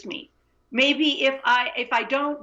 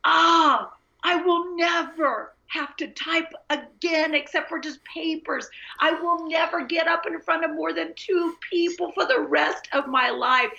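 put-on relieved teenage glee; 6 semitones higher than usual. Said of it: "I'm thinking".

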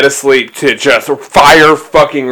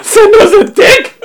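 surprised; 3 dB louder than usual. Real, not acted. Shocked echo of the slip.

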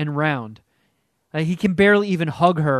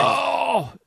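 after a gulp of beer; drinking beer mid-sentence; while drinking beer; 9 semitones higher than usual. Real, not acted. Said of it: "I'm drinking a lot of beer this episode".

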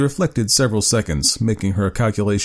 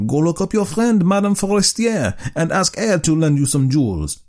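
eager husband; 9 semitones higher than usual. Acted. Husband voiced in story.

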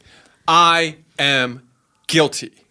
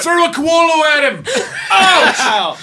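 shout; 9 semitones higher, 4 dB louder than usual. Acted.

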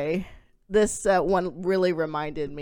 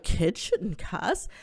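ordinary talk

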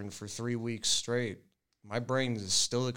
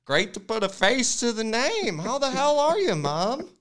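hammed-up hearty greeting; 9 semitones higher, 4 dB louder than usual. Mock hearty greeting to parents.

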